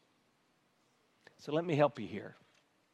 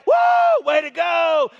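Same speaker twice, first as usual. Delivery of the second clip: whooping shout way up high; fan cheer; 13 semitones higher, 12 dB louder, slower than usual. Retold or imitated. Pastor imitating fans cheering in a stadium, shouting whoop. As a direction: cheer like your team just scored. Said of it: "They're gonna".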